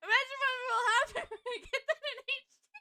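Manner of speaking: high, loud cracking up mid-sentence; laughing. Real, not acted. Player imagines the game in HD, laughing. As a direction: squeal it through helpless laughter.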